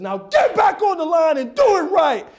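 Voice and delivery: loud, high barked order; angry coach bellowing a command; yelling coach. Acted. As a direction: shout the player back into line. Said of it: "So when a coach yells".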